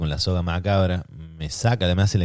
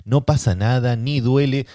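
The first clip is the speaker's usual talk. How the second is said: más aguda, en tono cantado de fórmula; frase típica imitada; 6 semitones higher, slower than usual. Retold or imitated. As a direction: imitar la frase de siempre del personal médico. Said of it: "La típica frase". (cliché médico dicho agudo y cantado)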